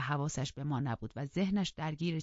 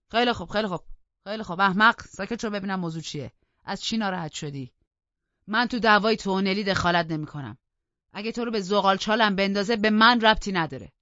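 loud, higher, exasperated cutting-off; impatient outburst; 4 semitones higher, 10 dB louder than usual. Acted. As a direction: shout him down impatiently. Heathcliff cuts Joseph off.

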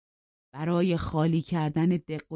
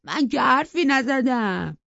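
put-on high, slow whiny boy; sly denial; 9 semitones higher, 7 dB louder, slower than usual. Edgar slyly claims he said nothing, high and slow. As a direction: play innocent, drawing it out. جوانک با حقه گفت.

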